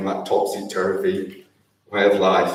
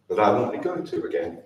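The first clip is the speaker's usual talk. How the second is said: ordinary talk, nothing marked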